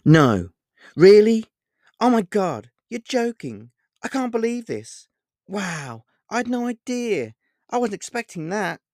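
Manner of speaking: mock amazement, pitched high and loud; high, gushing fake astonishment; sarcastically. A narrator's reading. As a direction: overplay the surprise to mock him. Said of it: "I said sarcastically with a smile".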